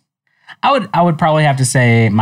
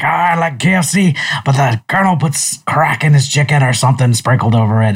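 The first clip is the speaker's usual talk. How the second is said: a bad, hammed-up Scottish accent, pitched higher; terrible Scottish accent; 3 semitones higher than usual. Podcast host doing a movie's Scottish dad, bad Scottish accent. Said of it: "That's a terrible Scottish accent".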